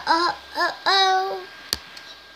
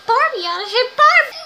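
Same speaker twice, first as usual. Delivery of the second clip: squealed silly phrase; 10 semitones higher, 9 dB louder than usual. Pretend.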